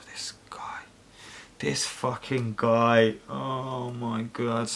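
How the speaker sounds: slow, hushed exasperation; weary disbelief. Real, not acted. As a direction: sigh it out quietly, dragging the words. Despairing at a commenter after sighing.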